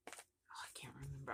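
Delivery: hushed whisper to herself; breathy forgetful aside. Real, not acted.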